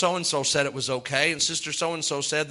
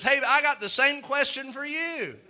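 teasing holler; 8 semitones higher than usual. Pretend. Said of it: "down the table hollers at you".

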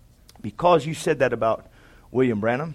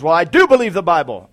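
getting mad; 3 semitones higher, 9 dB louder than usual. Retold or imitated. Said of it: "Now he's getting mad".

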